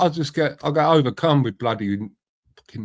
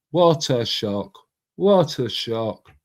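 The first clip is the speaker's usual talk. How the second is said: slow dismayed repeat; 2 semitones lower, much slower than usual. Real, not acted.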